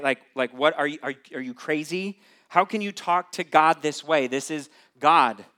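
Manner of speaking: loud, incredulous calling out; exasperated yell. Pretend. Preacher yelling at Jonah, loud and incredulous. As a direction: shout at Jonah in disbelief. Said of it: "Don't you just wanna yell out to Jonah".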